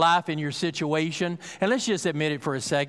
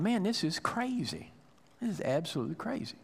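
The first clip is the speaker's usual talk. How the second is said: softer, muttered under the breath; doubting thoughts; 3 semitones higher, 6 dB quieter than usual. Retold or imitated. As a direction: let the doubt slip out quietly as if no one should hear. Soldiers' doubting thoughts, retold aloud.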